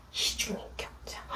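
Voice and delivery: barely audible whisper; very soft, breath-only aside; hushed accusation. Retold or imitated. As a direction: whisper it as a horrified secret thought. Child's secret thought of mother.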